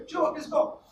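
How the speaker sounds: firm loud command to go fast; forceful command. Shown as a demonstration. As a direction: snap the order to make him hurry. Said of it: "then Shubho will go fast".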